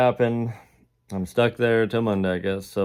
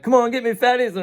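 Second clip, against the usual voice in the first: much higher, louder teasing taunt; 5 dB louder than usual. Retold or imitated. Taunting the guards chasing him, high and loud. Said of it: "I'm like".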